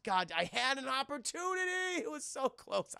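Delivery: high, mock anguished lament; hammed-up frustrated whine; very upset. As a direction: wail over the missed chance. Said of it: "He's very upset by it".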